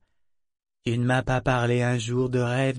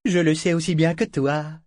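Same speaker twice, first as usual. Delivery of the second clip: higher and louder, quick retort; 5 semitones higher, 4 dB louder than usual. Acted. Ophelia throwing Althea's words back at her, a sharp retort. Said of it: "riposta Ophélie".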